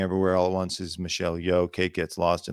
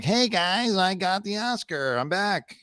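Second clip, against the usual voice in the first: higher, put-on regular-guy voice; lighter, higher voice with a stretched ending; Austin Butler's original voice; 9 semitones higher, slower than usual. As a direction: announce the return in a high, easy mock voice. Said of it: "he should walk in like".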